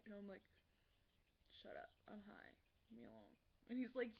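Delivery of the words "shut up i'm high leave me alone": tired and very soft, wanting to be left be; groggy retort. Retold or imitated. Drugged-up student to classmate, groggy and quiet.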